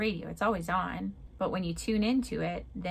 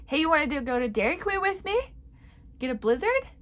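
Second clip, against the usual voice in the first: higher and louder, bouncy and eager; playful invitation; 9 semitones higher, 6 dB louder, faster than usual. Retold or imitated. Girl asking God to Dairy Queen, bright eager invite. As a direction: ask like you're inviting a best friend out for ice cream.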